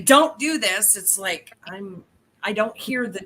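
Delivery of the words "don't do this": firm command. Retold or imitated. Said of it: "is when you say".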